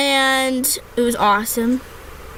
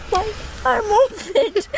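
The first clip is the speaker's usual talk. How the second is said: laughing; 6 semitones higher than usual. Real, not acted. Giggling fit while dressing.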